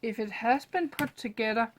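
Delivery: ordinary talk, nothing marked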